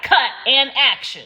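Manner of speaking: mock director. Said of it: "It was like".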